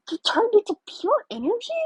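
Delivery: high, disbelieving yell. Acted. Air freaking out at the glowing hero, yelling. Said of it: "he yells".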